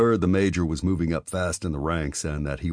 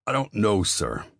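bitten off; 5 semitones higher than usual. A narrator's reading. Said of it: "He bit the words out".

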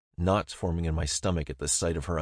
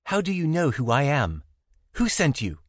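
a touch of alarm, higher; 7 semitones higher, 4 dB louder than usual. A narrator's reading. Zara demanding who sent him, mildly alarmed. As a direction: press him anxiously for answers.

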